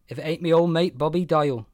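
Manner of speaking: put-on loud, high drunken Welsh slur; a Welsh drunk hailing an old mate loudly; boozy Welsh bellow, words slurring together; drunken slurred Welsh. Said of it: "he says in a drunken, slurred Welsh voice".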